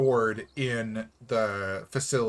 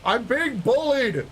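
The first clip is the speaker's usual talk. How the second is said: mock whiny complaint; 10 semitones higher, 3 dB louder, slower than usual. Mock complaint, high pitched.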